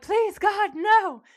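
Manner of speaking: high, loud desperate plea; terrified pleading. Retold or imitated. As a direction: beg for your life in terror. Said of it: "Benny's like".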